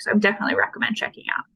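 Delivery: fast soft whisper; tossed-off aside, whispered; quick hushed plug. Real, not acted.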